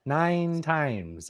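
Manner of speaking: high, stretched movie-quote voice; Ferris Bueller quote. Retold or imitated. Co-host quoting the film principal about absences, drawn-out imitation. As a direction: draw out both words, pitched well up. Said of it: "That's from Ferris Bueller".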